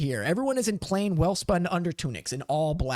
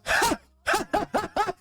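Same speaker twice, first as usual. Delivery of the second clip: high, hearty stage laugh; hammed-up "ha ha" guffaw; booming commander's laugh. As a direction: laugh it out big and delighted. Commander laughs on hearing the question.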